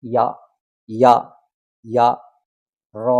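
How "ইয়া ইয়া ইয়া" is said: "ya" pronounced for learners to copy; demonstrating "ya". Shown as a demonstration. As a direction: say "ya" as a separate syllable. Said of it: "তাহলে কি হয়ে গেল?".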